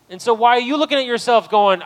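loud, high, fervent preaching to the church; full-force preaching. Real, not acted. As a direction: lift the room, preach it loud to the congregation.

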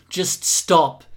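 insistent, pleading outburst. A narrator's reading. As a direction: beg them to stop. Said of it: "Jess pleaded".

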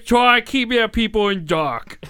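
loud, high, slurred mock Cockney; awful British accent. Shown as a demonstration. Agent on people kept in the dark, hammed-up British. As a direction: wail it in absurdly thick British. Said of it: "sound like a mentally handicapped Michael Caine".

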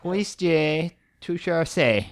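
attempted French. Shown as a demonstration. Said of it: "I don't even know how to say it".

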